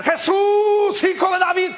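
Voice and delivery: a long, high, loud cry for help; blind man shouting. Acted. Blind Bartimeo crying out to Jesus, loud and high. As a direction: call out across the crowd to be heard. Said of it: "Bartimeo empieza a gritar".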